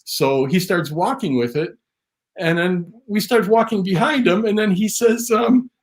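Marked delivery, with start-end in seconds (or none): none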